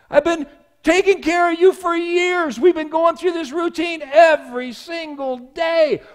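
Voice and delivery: high, loud, disbelieving cry of betrayal; anguished shouting. Retold or imitated. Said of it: "He looks at the snake and he goes".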